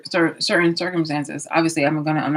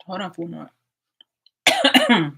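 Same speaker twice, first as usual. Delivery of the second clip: strained, choking voice; coughing up water; 2 semitones higher, 4 dB louder, faster than usual. Real, not acted. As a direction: speak through a coughing fit. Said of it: "This went down the wrong pipe".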